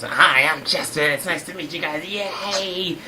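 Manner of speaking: eager guest introduction. Acted. Chespin meeting the hosts, eager low-voiced hello.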